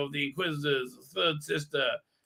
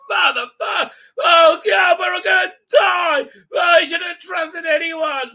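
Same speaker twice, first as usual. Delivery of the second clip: very high, loud screams of doom; hammed-up terror, screaming high; panicked screaming; 13 semitones higher, 8 dB louder than usual. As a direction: shriek it like death is coming. Character panicking, sure they'll die.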